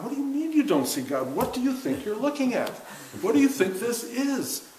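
rising, disbelieving questions; incredulous. Real, not acted. Preaching to the class about seeing God, incredulous. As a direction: ask as if it's obvious, amazed.

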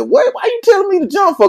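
very high, loud indignant outburst; retold angry disbelief. Man retelling his mad reply to his friend, high and indignant. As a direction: re-enact an outraged, incredulous comeback. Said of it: "So I got mad".

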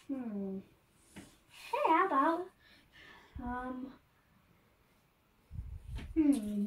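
usual speaking voice

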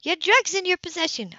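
put-on firm older sister. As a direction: lay out the terms steadily. Sybil accuses her sister.

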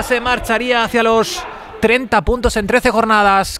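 ordinary talk, nothing marked

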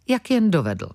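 usual speaking voice

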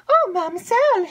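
high, loud eager maid's voice; breathless excited maid. Acted. Maid rushes in to tell Emily news, excited.

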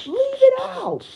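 high, loud drawn-out yell; outburst. Acted. Player breaks from blaming, high loud outburst. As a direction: burst out with a yell. Outburst after self-blame.

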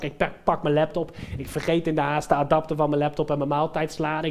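normal talking voice

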